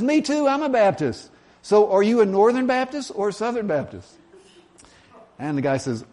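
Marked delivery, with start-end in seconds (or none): 0.0-4.1 high, gleeful acted excitement; eager and high-pitched, hammed-up; delighted agreement. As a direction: play up the thrill of a match. Joke character's delight grows.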